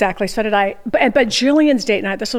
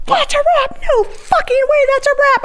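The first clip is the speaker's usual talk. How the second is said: much higher, incredulous and indignant; can't believe the shoot is over; outraged disbelief. Retold or imitated. Outraged retort to the wrap call.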